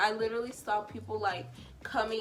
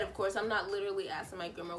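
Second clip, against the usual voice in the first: usual speaking voice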